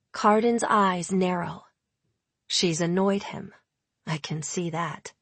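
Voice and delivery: ordinary delivery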